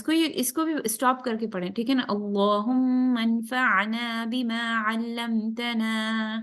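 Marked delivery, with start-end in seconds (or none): 2.1-6.4 slow, drawn-out Arabic prayer recitation, then a break. Shown as a demonstration. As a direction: chant it slowly and pause at the end. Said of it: "روک لیں یہاں پہ break".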